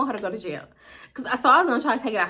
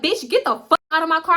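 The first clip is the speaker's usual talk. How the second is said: higher-pitched, forceful retold command; mock angry order; 6 semitones higher, 4 dB louder than usual. Cousin ordering friend out of car, mock angry. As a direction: act out a driver ordering someone out.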